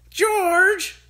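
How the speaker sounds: put-on high, loud yell of a nasty old woman; grandma yelling. A narrator's reading. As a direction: scream his name from above. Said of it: "Grandma yelled".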